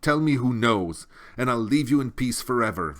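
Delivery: loud, quick urgent demand; insistent plea. A narrator's reading. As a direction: push her for the answer. Atreyu insisting.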